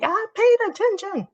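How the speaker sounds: high mock-proud self-quote. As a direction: play the proud kid showing off. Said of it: "pull one thing out and be like".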